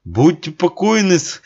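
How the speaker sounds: хрипло, высоко; прохрипеть, бодрясь. A narrator's reading. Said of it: "хрипит Косой". (хрипит Косой)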